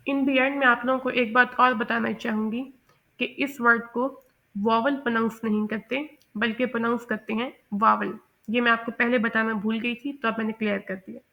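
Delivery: ordinary talk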